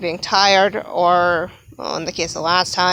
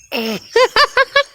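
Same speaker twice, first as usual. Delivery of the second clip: high burst of laughter. Real, not acted. Laughing at a mashed-up word, high real laugh. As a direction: crack up at your own slip.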